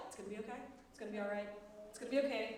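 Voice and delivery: low, soft, calming murmur; mock self-soothing. Teacher acting out calming down after a bad day, soft self-soothing. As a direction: settle yourself down softly after the tantrum. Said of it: "And then you're like".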